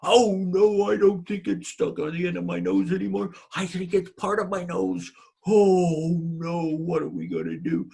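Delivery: husband panicking about his nose; despairing woodcutter. Acted. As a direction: moan that it's part of you now.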